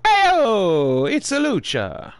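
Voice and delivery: a hint of a dramatic ring announcer drawing it out slowly; lightly hammed-up lucha announcer call; flamboyant announcer flourish. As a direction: toss it off as a grand, slow announcement. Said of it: "I don't know why I said it that way".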